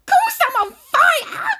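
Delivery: slow, high, indignant shriek; old woman yelling. A narrator's reading. Burning grandma snapping back at George, yelling shrilly. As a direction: yell it back at him, stretched out. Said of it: "she yelled".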